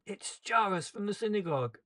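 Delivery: high, loud call across a crowd. A narrator's reading. Bystander recognizing Jairus in the crowd, calling out loudly. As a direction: shout his name to the crowd. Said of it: "calls out my name".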